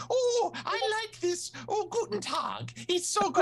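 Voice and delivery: a delighted German reader, way up high and drawn out; gushing German reader. Retold or imitated. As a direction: coo it out like a thrilled reader with a German accent. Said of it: "Maybe they say".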